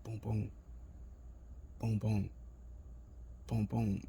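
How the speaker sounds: soft paired thumps with long gaps; imitated faint heartbeat. Head on his homeboy's chest, faint imitated heartbeat. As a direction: sound out a barely audible heartbeat. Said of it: "like, a faint ass heartbeat".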